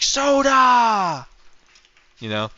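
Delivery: high, stretched mock Biden exclamation; Biden impression. Streamer finishing the meme, hammed-up Biden cry. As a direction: blurt it out like a confused old man. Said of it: "Biden comes in and it's like".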